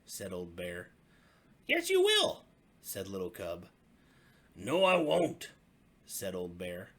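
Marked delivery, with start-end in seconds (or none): 1.7-2.5 very high, loud cub insisting; insistent little cub. Acted. 4.6-5.5 put-on Old Bear refusing the cub; stubborn old bear.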